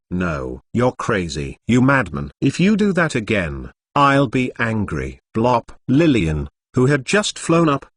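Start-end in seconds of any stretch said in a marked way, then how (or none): none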